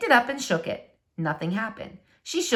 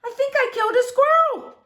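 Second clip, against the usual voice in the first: much higher, louder panicked squeal; panicky cry; 3 dB louder than usual. Acted. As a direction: cry out for help in a fluster. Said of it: "she said".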